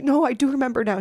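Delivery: usual speaking voice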